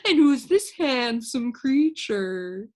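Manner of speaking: slow, smooth friendly stranger; oily polite gentleman voice; amiable gentleman. Acted. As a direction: greet him sweetly, slowly.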